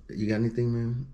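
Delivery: usual speaking voice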